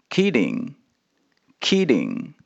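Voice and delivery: "kidding，kidding" drawn out slowly, syllable by syllable. Shown as a demonstration. Teacher slowly models "kidding" for students. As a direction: spell out "kidding，kidding" slowly with a clear d. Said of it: "第二".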